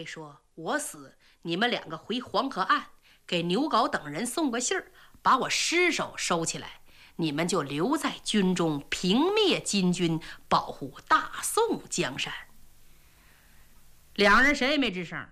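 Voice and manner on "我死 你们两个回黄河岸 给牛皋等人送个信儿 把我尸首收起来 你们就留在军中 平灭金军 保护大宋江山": low, grave last instructions; a dying general giving orders; calm marshal. Acted. 岳飞临终嘱咐. (低沉沉稳的嘱托)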